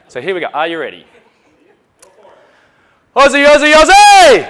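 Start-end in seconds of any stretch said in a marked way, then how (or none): 3.1-4.5 full-volume high chant, belted to the crowd; rally the room with a huge, high shout; Australian war cry. Pretend. Speaker leading the audience in a war cry, loud high shouting. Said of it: "we're gonna do the Australian war cry".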